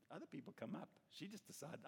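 ordinary delivery

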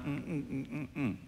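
soft little repeated hums; mimicked murmuring. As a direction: imitate gossiping with closed-mouth mm-mms. Imitates people's idle talk with hums.

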